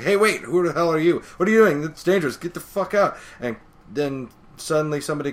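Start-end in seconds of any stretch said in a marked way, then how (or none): 0.0-3.2 higher, louder shouted warning; yelling firefighter. Retold or imitated. Firefighter shouting at a stranger entering the fire, loud yell. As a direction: bark at the intruder to get out. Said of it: "the firefighter's like".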